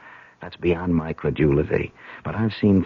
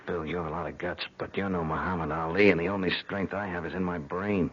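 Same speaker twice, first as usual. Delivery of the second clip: softer, hushed wry remark; 8 dB quieter than usual. Acted. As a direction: keep your voice right down. Sneaking past armed zombie below.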